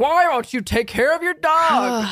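slow, scolding sing-song of an online critic; mock judgy commenter. Mocking a stranger who scolds her about her dog. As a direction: drag it out like a preachy stranger online.

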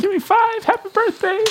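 very high excited squeal; mock cheer. Host congratulating cohost on her birthday treat, squealed high. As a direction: celebrate her like a kid.

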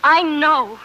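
rising to a loud, fervent outburst; loud declaration. Acted. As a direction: proclaim it loudly. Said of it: "please be quiet".

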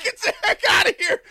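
high, loud laughing exclamation; excited disbelief. Retold or imitated. Host retells his reply to new neighbor, excited shout. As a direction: re-enact thrilled disbelief at a friend's news.

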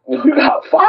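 high disbelieving rise on five; stunned echo, pitch shooting up at the end; incredulous. Retold or imitated. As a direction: ask it like you can't believe the number. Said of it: "And I looked at her like".

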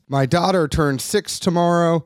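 ordinary talk

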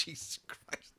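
unvoiced whisper after laughing; spent, whispered amazement; breathless disbelief. Real, not acted. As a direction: let it out softly on the breath. Winded reaction after laughing.

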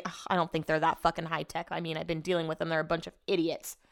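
usual speaking voice

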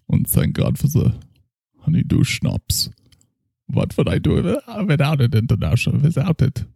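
put-on a drunk German doctor praising his schnapps; heavy German accent, tipsy. Character continues.

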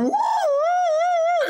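very high slow wailing siren imitation; siren noise. Host imitating the escort siren, high slow wail.

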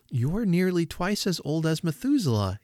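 put-on pointed child. A narrator's reading. Child trying to impress old man.

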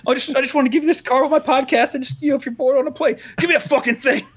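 high, put-on nervous fan voice; pleading fan. Fan handing his idol a podcast card, high mock pleading.

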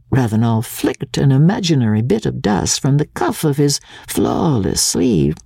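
ordinary delivery, nothing marked

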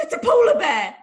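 high, loud panicked announcement; alarmed exclaiming. Retold or imitated. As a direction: blurt out the alarm. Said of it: "And I said".

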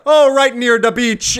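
lightly imitated Jamaican accent, high and loud; slow, high mock Jamaican line; mock fake Jamaican. Podcaster quoting the film to friends, mock Jamaican accent. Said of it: "has a terrible fake accent".

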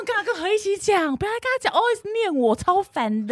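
high-pitched imitation of a whining coworker; Kimi. Imitating a coworker griping about being nagged, high whiny voice. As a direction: mimic an annoyed gripe, pitch way up. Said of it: "模仿得好像哦".

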